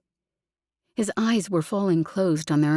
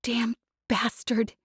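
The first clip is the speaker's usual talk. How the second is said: whispered furious hiss; hissed curse, no voice; 5 semitones higher, 4 dB quieter than usual. Acted. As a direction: spit it out in a breathless whisper. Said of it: "she hissed".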